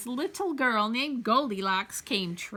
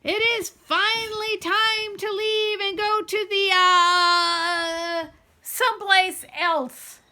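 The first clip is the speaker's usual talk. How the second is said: dinosaur papa voice; 7 semitones higher, 5 dB louder than usual. A narrator's reading.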